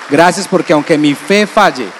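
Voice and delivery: a loud, fervent prayer of thanks; loud, full-voiced thanks; loud thanks to the Lord. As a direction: cry it out with gratitude. Congregation invited to thank the Lord.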